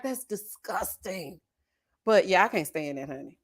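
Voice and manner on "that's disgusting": soft, slow, drawn-out disgust; disgusted reply. Retold or imitated. Mom answering her son, soft slow disgust. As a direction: let the disgust sink in, quietly and slowly. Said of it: "I'm like".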